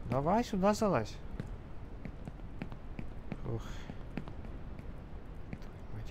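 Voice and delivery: ordinary talk, nothing marked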